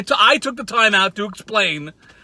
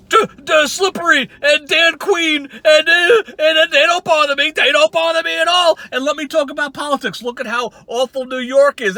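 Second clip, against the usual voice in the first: high, loud mocking whine of a rival; mocking Walter; 9 semitones higher, 5 dB louder than usual. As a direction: ham up a rival's rant, higher and louder. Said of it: "Your video is just like".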